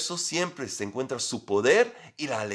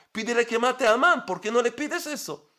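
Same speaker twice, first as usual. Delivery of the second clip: impatient objection; 10 semitones higher than usual. Pretend. Preacher voices listener's impatient objection.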